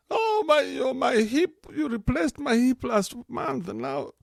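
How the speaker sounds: put-on high, whining old-woman voice, slowing down; complaining old lady. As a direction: wail about a hip like a fussy grandma. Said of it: "old people are just scared of everything".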